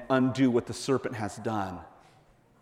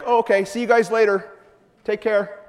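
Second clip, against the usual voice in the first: breezy, higher, louder see-you-later; casual goodbye; 8 semitones higher, 4 dB louder than usual. Pretend. Jesus breezily waving off the two disciples. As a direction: toss it off like a friend leaving.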